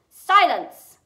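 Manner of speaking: huge, high-pitched bellow; yelled. A narrator's reading. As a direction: explode to silence the boy. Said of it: "yelled Uncle Vernon".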